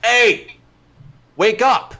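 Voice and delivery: calling to wake someone. Acted. Derek throws water at player's face, calling to wake him. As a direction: call out to rouse a sleeper.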